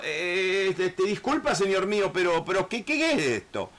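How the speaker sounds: flustered plea. Retold or imitated. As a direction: stumble as if caught off guard. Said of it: "como que está diciendo".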